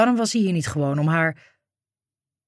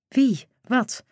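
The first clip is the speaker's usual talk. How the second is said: panicked gasps; 6 semitones higher than usual. A narrator's reading. Panic, can't breathe.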